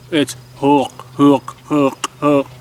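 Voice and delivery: hook from the back of the throat, almost grunted. Shown as a demonstration. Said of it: "the back of my throat to kinda close a little bit and almost grunt".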